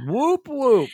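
high cheering whoop whoop; official whoop whoop. Acted. Host cheering the new fan, high and celebratory. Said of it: "here is your official".

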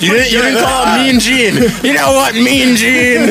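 high-pitched wrestler imitation; Macho Man. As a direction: playfully mimic a wrestler addressing his interviewer. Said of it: "Gotta work on my Macho Man".

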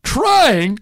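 very high, loud furious rant; Lasorda ranting. Retold or imitated. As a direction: scream the word try at him. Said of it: "Lasorda goes".

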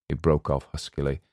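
plain reading voice

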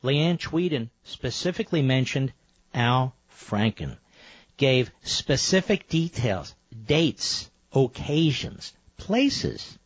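measured, item by item, pausing between each; slow, pointed lecturing. Real, not acted. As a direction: spell out the evidence slowly for a dense listener. Lecturing caller slowly, item by item.

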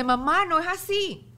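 daughter protesting. Retold or imitated. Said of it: "me dice".

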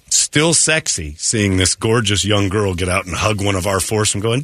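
ordinary delivery

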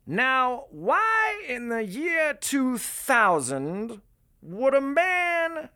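very high, loud, slow put-on voice; hammed-up, drawn-out high-pitched pronouncement; Reggie McDaniels impression. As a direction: stretch each word out high and theatrical. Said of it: "he's like, says".

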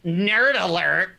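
slow, stretched-out cartoon heckle; mocking cartoon call, drawn out; Bugs Bunny. Quoting a bad joke from Space Jam, cartoon heckle. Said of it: "And then Bugs Bunny goes".

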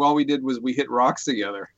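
ordinary delivery

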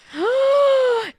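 loud, high dramatic gasp; big scandalized intake of breath; shocked gasp. Real, not acted. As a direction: gasp at juicy gossip. Reacting to celebrity divorce gossip.

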